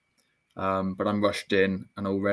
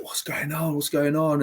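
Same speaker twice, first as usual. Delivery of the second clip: high-pitched mock scream, not full volume; retold screaming; 6 semitones higher than usual. Patient on ketamine in the helicopter, mild retold scream. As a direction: imitate your own panicked screaming, held back. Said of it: "I was just screaming".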